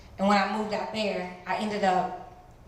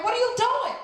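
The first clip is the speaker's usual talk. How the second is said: startled question; 5 dB louder than usual. Retold or imitated. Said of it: "I'm like".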